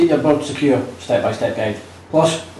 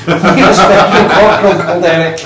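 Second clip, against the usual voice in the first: laughing; 4 semitones higher, 4 dB louder than usual. Real, not acted. Reading a silly magazine aloud.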